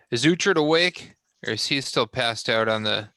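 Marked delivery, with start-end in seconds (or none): 0.1-1.1 raised call for Uhtred. Acted. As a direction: call his name up the room. Calling out for Uhtred.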